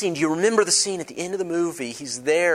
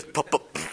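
engine sputter; 3 semitones lower, 6 dB quieter than usual. Retold or imitated. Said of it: "It goes".

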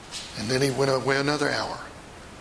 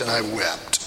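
sad fade; 5 semitones lower than usual. Acted. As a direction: let it sink away in grief. Peter's weeping fades.

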